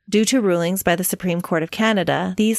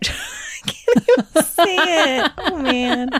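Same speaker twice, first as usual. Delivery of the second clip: laughing; 10 semitones higher, slower than usual. Real, not acted. Giggling, can't say the name.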